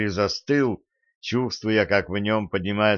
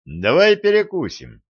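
high, loud, breezy suggestion; bright-spirited invitation, pitched well up; cheerful; 8 semitones higher, 5 dB louder than usual. A narrator's reading. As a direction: perk right up and propose it gaily. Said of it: "весело предложил он".

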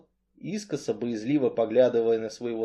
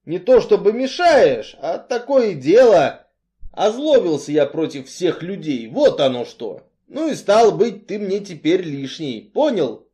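loud, insistent explanation; blunt Artyom, worked up; 5 semitones higher, 6 dB louder than usual. A narrator's reading. Strongman tells Cain he is unwanted, forcefully. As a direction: force out the awkward truth.

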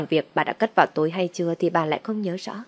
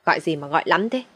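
grumpy scolding. A narrator's reading. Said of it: "mụ lại chửi".

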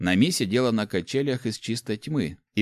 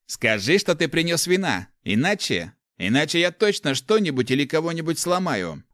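raised, emphatic ultimatum; angry threat; 8 semitones higher, 4 dB louder than usual. A narrator's reading. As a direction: threaten with a touch of fury. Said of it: "Оглядел комнату гневным взглядом". (сердито, с угрозой)

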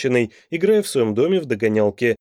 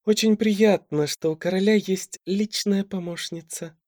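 haughty; 6 semitones higher than usual. A narrator's reading. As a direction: look down your nose at her. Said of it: "надменно произнесла Алика".

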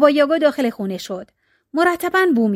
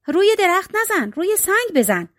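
high-pitched storybook call; mild cry from above; 7 semitones higher than usual. A narrator's reading. Child up the tree warning the axe-wielding witch, mild high cry. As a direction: call down high, without real volume.

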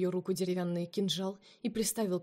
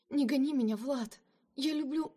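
desperate plea; 6 semitones higher than usual. A narrator's reading. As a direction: beg him not to send her away. Lina begs Vlad not to leave.

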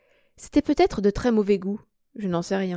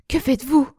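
higher, louder startled cry; alarmed cry; 6 semitones higher, 4 dB louder than usual. A narrator's reading. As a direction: cry out in alarm at him kneeling. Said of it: "m'écriai-je". (s'écrie, alarmée, plus haut et plus fort)

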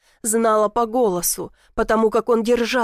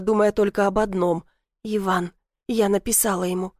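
plain reading voice

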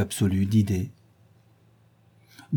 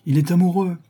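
higher, louder narrated exclamation; exclaiming; 9 semitones higher, 5 dB louder than usual. A narrator's reading. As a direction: cry it out as a lively hostess. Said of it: "s'écria Lady Narborough".

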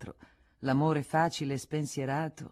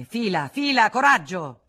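loud, urgent urging; shouting to the driver; 6 semitones higher, 12 dB louder than usual. Acted. Frédéric shouts at the postilion to drive faster, loud and urgent. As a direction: yell at the coachman to hurry. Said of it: "Federico gridò al postiglione".

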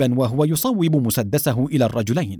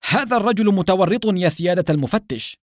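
raised, higher-pitched accusation; crying out; 6 semitones higher than usual. A narrator's reading. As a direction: call out the accusation, pitch lifted. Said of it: "صاح أدهم وهو يشير إلى البدين".

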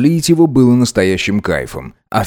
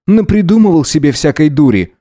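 higher-pitched, irritated reproach; angry; 6 semitones higher, 4 dB louder than usual. A narrator's reading. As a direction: dismiss it crossly. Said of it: "зло прищурился Гидеон". (зло, раздражённо)

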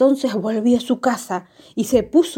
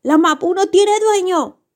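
plain reading voice

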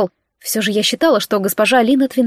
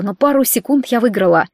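ordinary delivery

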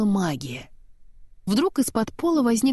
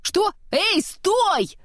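very high, raised yell of alarm; startled yell; 12 semitones higher, much slower than usual. A narrator's reading. Kristoff dragged off the cliff, very high yell. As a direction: yell in panic as she jumps. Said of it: "заорал Кристоф".

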